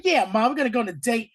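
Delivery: put-on a boy thrilled to take his mom out; excited kid. Oz excited to his mom about a date, quick and eager. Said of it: "He's like... He's so excited".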